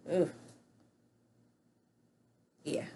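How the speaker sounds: ordinary talk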